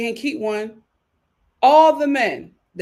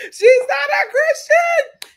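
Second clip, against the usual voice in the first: loud, slow, laughing high cry; laughing shout; 8 dB louder, slower than usual. Real, not acted.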